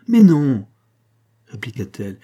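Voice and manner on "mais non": loud, high angry retort; irritated denial. Acted. Monique correcting Marin's suspicion, angry outburst. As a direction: burst out in exasperation. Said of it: "avec une sorte de colère".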